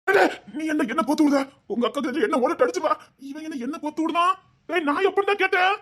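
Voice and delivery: high, rising outburst of accusation; indignant protest. Acted. Uncle protesting that he's being set up, indignant. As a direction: protest sharply, pitch climbing to the end.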